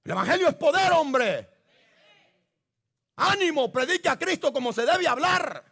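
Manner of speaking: fiery pulpit preaching. Acted. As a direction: preach it out to the congregation with force. Preacher exhorting the church.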